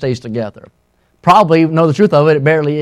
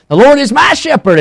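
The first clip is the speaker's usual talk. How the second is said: put-on higher, louder childlike recitation; nervous girl reciting; 9 semitones higher, 5 dB louder than usual. Little girl retrying her psalm before church, nervous. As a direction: imitate a nervous child starting a verse. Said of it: "She kept getting nervous".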